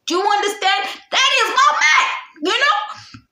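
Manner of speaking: high, pointed disbelief; indignant. Real, not acted. Telling viewers the girl was with her man, indignant. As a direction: make them see the outrage.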